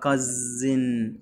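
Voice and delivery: "cousin" slowly, with a long buzzing Z. Shown as a demonstration. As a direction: draw out the Z in "cousin". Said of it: "والإس هنا منطقها ز". ("cousin" بحرف ز ممدود)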